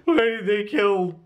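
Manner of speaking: high, slow wailing despair, hammed up; mocking a viewer sobbing over the little girl's death; mock tear-jerker. Mock weepy wail about a cartoonish tragedy. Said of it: "a big tear-jerking".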